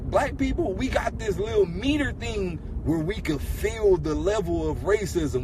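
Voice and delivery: normal talking voice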